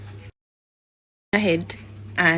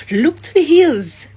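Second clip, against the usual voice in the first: much higher, louder and slow "Look to the hills"; slow, loud proclaiming of "hills"; fervent cry; 8 semitones higher, 8 dB louder than usual. Real, not acted. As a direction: call it out like a preacher lifting the crowd. Repeats scripture with fervour.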